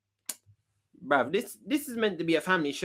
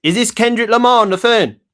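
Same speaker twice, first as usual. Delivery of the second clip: loud phone call; 5 semitones higher, 11 dB louder than usual. Real, not acted. Prank call, raising voice.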